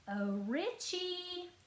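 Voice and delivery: high, slow sugary coo. A narrator's reading. Sister cooing sweetly to lure her brother. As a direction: sweeten it like bait for him. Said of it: "I cooed".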